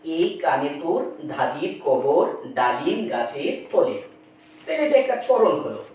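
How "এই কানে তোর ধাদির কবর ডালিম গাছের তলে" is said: slow, lower recitation of a verse line; poem recitation. Shown as a demonstration. Teacher reciting a verse line as an example, slow recitation. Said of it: "যেমন এখানে আমি বললাম".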